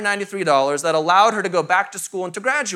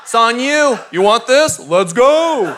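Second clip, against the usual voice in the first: mock challenge; 5 dB louder, slower than usual. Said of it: "we look at God and go".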